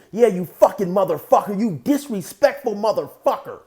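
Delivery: loud, high-pitched acted rage; heated mock tirade; angry confrontation. Acted quote of Ken confronting him.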